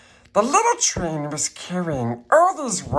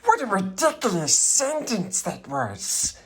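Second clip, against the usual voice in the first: higher, mocking creature aside; scornful aside; 6 semitones higher than usual. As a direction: scoff at the book.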